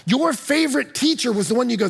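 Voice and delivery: usual speaking voice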